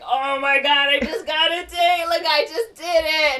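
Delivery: high, loud, imitated squeal of triumph; super hyped. Usnavi celebrates getting a date, super hyped. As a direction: burst out like you just landed a date. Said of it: "he's, like, super hyped".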